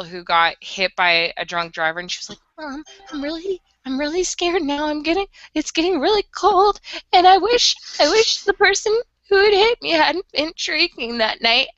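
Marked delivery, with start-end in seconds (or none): 2.6-11.7 a hint of a frightened girl, higher; lightly acted dying-girl plea; scared girl's monologue. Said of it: "read this, like, monologue of this girl".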